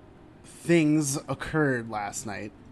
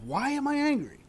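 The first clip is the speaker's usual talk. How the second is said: self questioning; 12 semitones higher than usual. Retold or imitated. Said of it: "ask myself".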